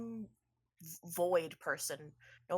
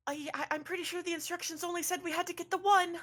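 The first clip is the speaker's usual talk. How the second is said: hesitant high objection; 11 semitones higher, faster than usual. Acted. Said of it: "Mirsine goes".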